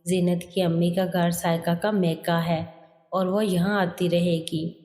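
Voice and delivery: ordinary delivery, nothing marked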